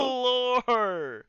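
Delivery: loud, high cry of delight; excited shout. Real, not acted. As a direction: belt out the word lore. Streamer crying out the word.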